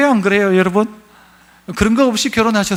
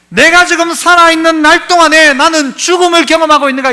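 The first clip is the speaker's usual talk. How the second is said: higher, louder searching question; fervent preaching; 7 semitones higher, 6 dB louder than usual. Acted. Pastor voicing the self-examining question. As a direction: ask it with full preaching force.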